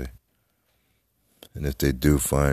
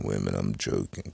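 usual speaking voice

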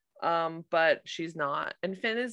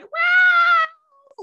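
very high, loud mock shriek; a mom shrieking at the dog; retold shriek; 10 dB louder than usual. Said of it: "she was saying like".

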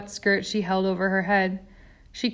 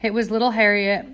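usual speaking voice

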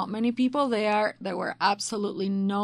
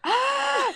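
big high gasp of fake outrage; mock-shocked gasp. Mother hearing daughter's cheeky claim, mock-shocked gasp. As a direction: gasp as if scandalised.

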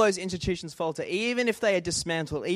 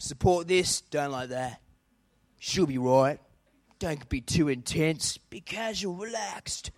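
laid-back Aussie; 4 semitones lower, 4 dB quieter than usual. Acted. Preacher acts a laid-back Aussie.

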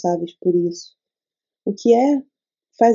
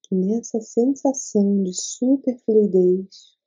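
guided meditation. Acted. Leads a meditation.

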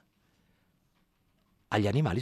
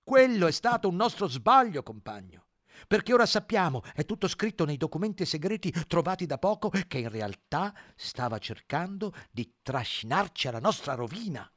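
higher, smooth-talking pig; pig character voice; 7 semitones higher, 4 dB louder than usual. Acted. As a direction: persuade like a sly propagandist. Squillo the pig argues.

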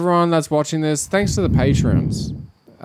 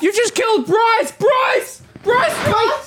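much higher shouted panic; shocked scream; slower than usual. Acted. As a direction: scream the name in horror. Nurse screams at surgeon.

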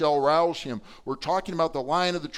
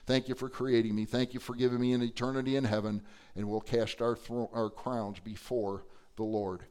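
soft, hushed thanks to God, much quieter; a touch of quiet, grateful prayer; kneeling, offering thanks softly; humble thanks; 6 semitones lower, 7 dB quieter than usual. Pretend.

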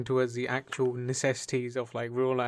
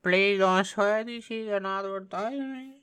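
mock Pakistani accent; 7 semitones higher than usual. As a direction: scold politely in a silly high Pakistani accent. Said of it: "I'm doing it in Pakistani".

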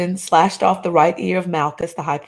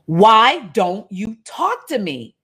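slow, pressing demand a touch higher; 5 semitones higher, 3 dB louder, slower than usual. Acted. Pilate pressing silent Jesus with a slow, firm demand. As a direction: lean on Pilate's impatience, slowly. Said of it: "Pilate demanded".

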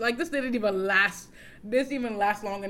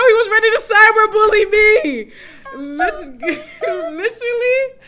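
loud, high laugh-crying; laughing hysterically; 10 semitones higher, 13 dB louder than usual. Real, not acted. Telling her friend about the bully, loud laugh-crying. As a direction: howl it through tears of laughter. Cracking up at his behaviour.

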